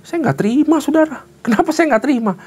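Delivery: high, passionate protest; a father's heated, high-pitched refusal; indignant father. Real, not acted. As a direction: cry out that you won't accept it.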